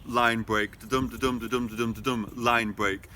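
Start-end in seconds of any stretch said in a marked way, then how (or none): 0.8-2.3 da-dum in a regular low pulse, DUM stressed; iambic beat. Shown as a demonstration. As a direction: count out da-dum evenly, weak then strong. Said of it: "plays around a lot with the rhythm".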